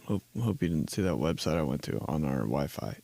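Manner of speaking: low, very quiet nervous mumble; nervous undertone. Acted. Worker worried about the office Wi-Fi log, nervous mumble. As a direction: say it under your breath, hoping nobody hears.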